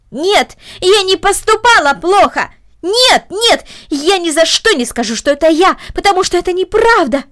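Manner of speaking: high, loud insistent denial; refuse, shouting at full force; desperate shout. A narrator's reading. Girl denying the deed to her aunt, loud shout.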